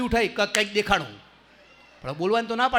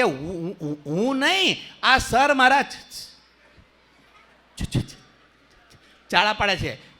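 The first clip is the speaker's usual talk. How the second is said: stuck on ચ-, almost voiceless; boy stammers his defense; mock stammer; much slower than usual. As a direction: stammer through ચ- repeatedly.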